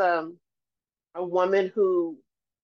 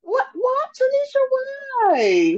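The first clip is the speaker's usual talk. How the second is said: high, loud surprised squeal; startled and delighted; 5 dB louder, slower than usual. Real, not acted. Surprised call to a friend.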